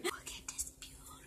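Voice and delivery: soft whisper. Real, not acted. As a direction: whisper it under your breath. Whispering aside.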